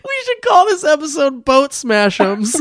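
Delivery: high squealing laugh-cry; crying with laughter. Real, not acted. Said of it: "laughing hard".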